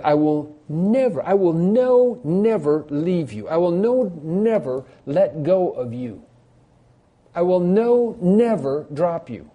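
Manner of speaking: NEVER drawn out slowly after a high, punched NO; emphatic promise. Acted. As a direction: lean hard on "no" and "never", stretching "never". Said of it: "this is an emphatic statement".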